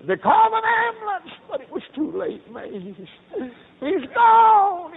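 high, loud, voice breaking into a wail; grieving father sobbing that his son is gone; crying out in grief. Retold or imitated. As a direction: let the grief burst out on the news. Father retelling son's death, weeping.

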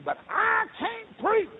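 high, drawn-out preacher's cry; pleading, stretched holler; anguished protest. Retold or imitated. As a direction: wail it out slow and loud. Retold defiant cry to God.

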